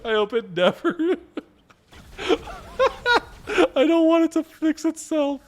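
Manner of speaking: high squeaky words through real laughing; laughing too hard to talk; helpless laughter. Real, not acted. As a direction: squeal it out while cracking up. Cracking up at the glitch.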